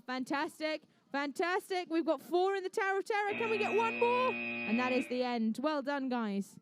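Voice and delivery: upbeat match commentary, keeps rolling; live play-by-play. Acted. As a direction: call the robot match as it happens.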